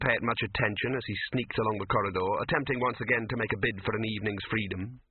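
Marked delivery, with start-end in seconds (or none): none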